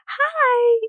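high, loud excited hi; mom's big hello. Retold or imitated. Mom greeting her daughter home, high and loud. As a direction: sing out a delighted greeting. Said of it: "She's like".

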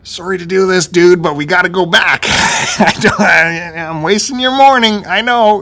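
loud, high-pitched apology breaking into a laugh; exasperated apology. Acted. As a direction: apologize at full voice, worked up. Acted loud apology to friend.

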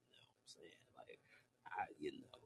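voiceless whisper to her alone; whispered aside. Real, not acted. Telling Grace privately they can talk off record, whispered. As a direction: lean in and breathe it privately.

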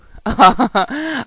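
loud, high guffaw; burst of laughter. A narrator's reading. Knight bursting out laughing at the dragon girl, guffawing. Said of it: "Rytíř se dal do smíchu".

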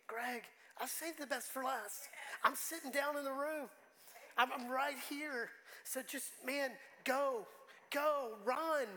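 emotional. Real, not acted. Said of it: "I can't say it without getting emotional".

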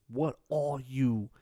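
slow, menacing chef question; lightly voiced stern chef; Gordon Ramsay. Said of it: "meanest things I've ever seen".

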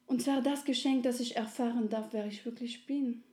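high, eager, fervent promise; little soul. Acted. Little soul thanks friendly soul, high and fervent. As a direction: insist warmly, full of thanks.

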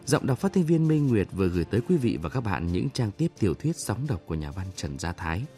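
ordinary delivery

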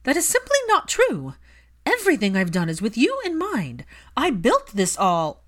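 mother snapping back at her son, high and loud; set off, angry. A narrator's reading. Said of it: "That set her off".

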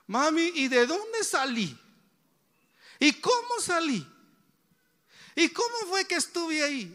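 put-on high, eager child's questions; a child asking his mother where he came from, curious. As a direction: ask like a small kid pestering mom. Child questioning mother about birth.

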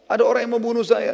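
acted high, loud cry for help; alarmed victim. Wounded Abu Rafi' telling his supposed helper, acted alarm. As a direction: play a victim pleading, pitched well up.

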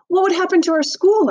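put-on high, anxious child asking; anxious girl voice. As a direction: ask it worried and young.

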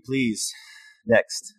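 ordinary talk, nothing marked

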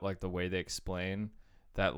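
ordinary delivery

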